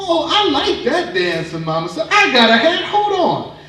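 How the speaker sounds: mama joining the new dance, eager and high; delighted high mama. Acted. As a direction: squeal with delight like a thrilled mother.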